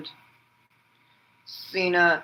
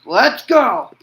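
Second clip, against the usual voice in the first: loud, higher "Let's go!" battle cry; shouted rally cry; 5 semitones higher, 10 dB louder than usual. Retold or imitated. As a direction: yell it to fire up the crowd. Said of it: "He goes".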